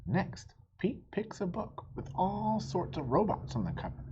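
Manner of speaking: usual speaking voice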